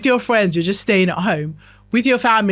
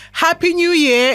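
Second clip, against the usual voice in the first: mock cheery greeting; 9 semitones higher, 4 dB louder than usual. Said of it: "I won't wanna see you next year going".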